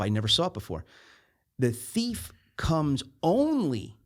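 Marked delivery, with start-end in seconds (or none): 1.6-4.0 "only" drawn out long and high; slow down and lean hard on "only"; "only" stretched with a raised pitch for emphasis; stress on "only". Real, not acted. Highlighting a newly noticed word.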